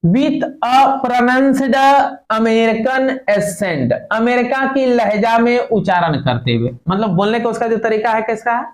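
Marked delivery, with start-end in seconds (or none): 0.0-3.9 very slow, word by word; deliberate slow classroom reading; slow dictation. Shown as a demonstration. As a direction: read it out slowly for students. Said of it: "teacher reading text slowly".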